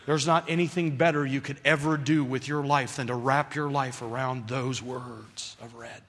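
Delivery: usual speaking voice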